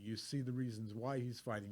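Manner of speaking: ordinary talk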